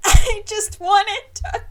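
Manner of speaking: high, loud, slow fake sob; mock crying. Mary sobbing at Twyla, hammed-up. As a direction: break off mid-sob.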